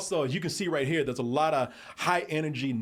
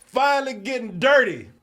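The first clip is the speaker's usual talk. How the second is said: much louder, much higher hype shout; excited; 10 semitones higher, 9 dB louder than usual. Real, not acted. Podcaster hyping the show to his co-hosts. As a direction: shout it out like big news. Hyping Marvel going hard.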